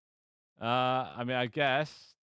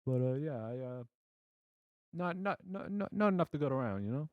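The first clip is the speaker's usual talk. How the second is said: higher, hesitant mock Italian; botched Italian accent; 4 semitones higher than usual. Blacksmith explaining stock, badly faked Italian accent. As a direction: stumble along in a failing Italian accent. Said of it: "I cannot do an Italian accent".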